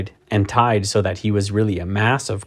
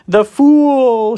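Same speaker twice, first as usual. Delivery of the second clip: high, loud witch's cry; 14 semitones higher, 5 dB louder than usual. A narrator's reading. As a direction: cry out in triumph like a wicked witch. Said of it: "she cried".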